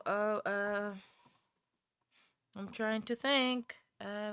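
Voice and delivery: ordinary delivery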